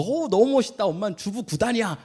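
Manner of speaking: put-on high, eager child voice; child's compliment. Young boy praising his mother's kimbap, eager and high. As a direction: gush like a little boy praising mom. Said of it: "이렇게 딱 얘기했어요".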